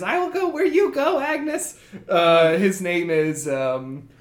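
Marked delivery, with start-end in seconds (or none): 0.0-1.8 high, lilting devoted servant; earnest little creature voice; Gerby voice. Acted. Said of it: "he bows deeply and he says".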